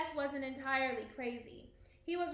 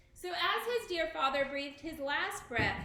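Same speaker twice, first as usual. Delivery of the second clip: ordinary delivery, nothing marked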